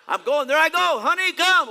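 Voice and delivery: high, loud hammed-up holler; calling out. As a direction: shout to a spouse to come along. Acting a churchgoer calling spouse.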